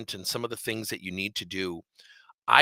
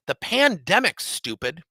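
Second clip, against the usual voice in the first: retold angry retort; 7 semitones higher, 6 dB louder, slower than usual. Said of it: "He called me stupid".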